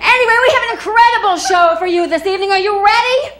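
loud, fast showbiz host voice; announcer hype. Acted. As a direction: pump up the crowd like an MC. Host hyping the crowd.

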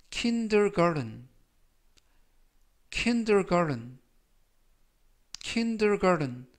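kindergarten slowed right down, the t softened to a flap; model pronunciation. Shown as a demonstration. Teacher drills kindergarten with students, slow and clear. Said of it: "garden이 아니라 garden".

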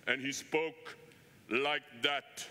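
a hint of a giant Russian pastor; huge Russian voice. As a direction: lightly put on his booming Russian voice. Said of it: "he had a huge Russian voice".

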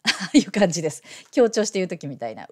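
ordinary talk, nothing marked

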